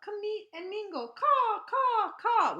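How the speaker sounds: high loud cawing; crow call. Retold or imitated. Reading the crow's call to kids, loud high caws. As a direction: caw out like a crow.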